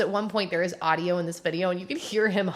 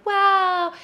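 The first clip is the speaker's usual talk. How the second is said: mock wow; 12 semitones higher, 7 dB louder than usual.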